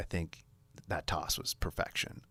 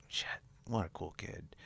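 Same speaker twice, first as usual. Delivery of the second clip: whispered aside; 7 dB quieter than usual. Retold or imitated. Inner thought, whispered.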